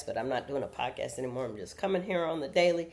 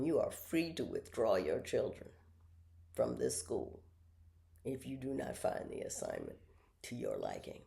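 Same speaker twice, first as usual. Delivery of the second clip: headmaster; 2 semitones lower, 7 dB quieter than usual. Retold or imitated. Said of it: "And the headmaster".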